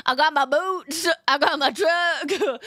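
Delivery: lightly put-on Southern drawl, slow; mock Southern country. Streamer jokes about being country, in a mild mock Southern accent. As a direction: swagger through it in a hammed-up Southern accent. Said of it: "that deep southern country".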